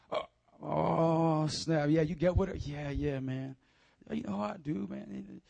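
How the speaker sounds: put-on soft, low slangy teen; hammed-up cool schoolkid mumbling along; high schooler. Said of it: "my best impression of a high schooler".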